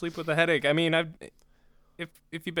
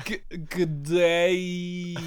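slow, stretched Australian greeting; accidental Australian accent; 2 semitones higher, much slower than usual. Pretend. Co-host copying the failed attempt, slow mock Australian accent. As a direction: drag out a fake Australian greeting. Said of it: "we're both going Australian accidentally".

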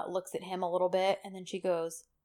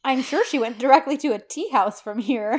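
higher, louder sassy jab; sassy retelling; 4 semitones higher, 7 dB louder than usual. Retold or imitated. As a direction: imitate her sass with a lifted, emphatic voice. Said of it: "I love the sass here".

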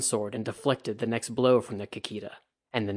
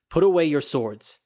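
loud, higher command; commanding order; 4 semitones higher, 6 dB louder than usual. A narrator's reading. Toturi ordering the drunk samurai to sheathe swords, loud command. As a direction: stop them with authority.

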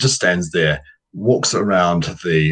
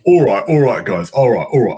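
hype man; 6 semitones higher, 4 dB louder than usual. Acted. Grabbing the mic, hyping the crowd.

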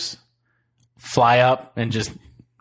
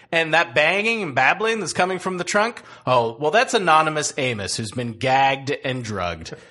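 podcast intro; 5 semitones higher than usual. Acted. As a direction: announce the show with flair. Host reads the podcast intro.

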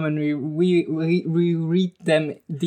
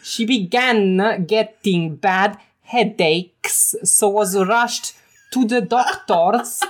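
slow, higher mock Italian accent; 3 semitones higher than usual. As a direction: lean into a hammy Italian accent. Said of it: "I can also do Italian".

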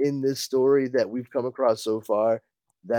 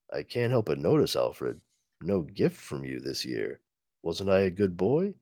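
low, quiet, mock-hurt Batman; low brooding hero voice; low teasing Batman; 8 semitones lower, 8 dB quieter than usual. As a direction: sulk at the butler in a deep hush.

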